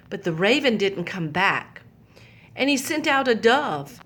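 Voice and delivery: usual speaking voice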